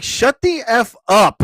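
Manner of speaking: exasperated imitation telling someone off; mock outraged German. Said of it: "Every German is like".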